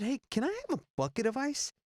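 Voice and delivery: high-pitched cajoling question; acted request. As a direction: act out a request well above normal pitch. Acting out his request.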